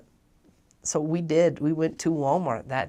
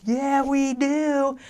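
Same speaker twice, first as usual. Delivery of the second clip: much higher, louder and slow, delighted; eager yes; 13 semitones higher, 9 dB louder, much slower than usual. Retold or imitated. Companion grabbing the cookies and accepting, excitedly. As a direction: stretch it out in triumph.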